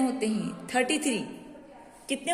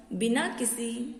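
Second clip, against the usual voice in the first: usual speaking voice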